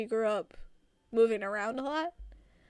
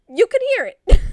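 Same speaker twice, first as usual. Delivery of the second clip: excited; 10 semitones higher, 17 dB louder, faster than usual. Real, not acted. Excited exclamation after story.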